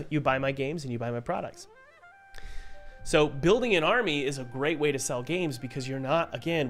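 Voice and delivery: ordinary delivery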